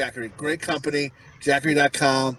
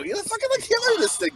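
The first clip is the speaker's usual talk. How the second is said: exasperated scolding; faster than usual. Retold or imitated. Retells scolding his kid.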